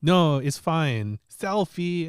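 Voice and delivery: put-on a boy ignoring his uncle's advice; imitating stubborn kid. Nephew voiced in a story.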